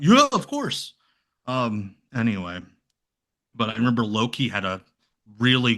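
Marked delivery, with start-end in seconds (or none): 0.0-0.9 high, loud eager agreement; exaggerated mimicry of instant agreement; Ian. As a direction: imitate an over-keen yes, pitched way up. Imitating Ian agreeing to anything.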